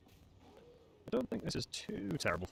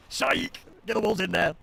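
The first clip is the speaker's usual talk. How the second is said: loud gleeful shout; spring the trap, shouting; triumphant shout; 6 semitones higher, 7 dB louder than usual. Real, not acted. Triumphant trick on opponent.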